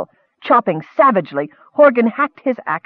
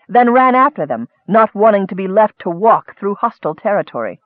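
plain reading voice

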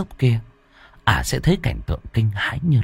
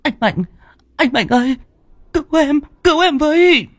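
terrified screaming. A narrator's reading. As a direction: scream for help through the door. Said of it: "Ả đập cửa gào thét".

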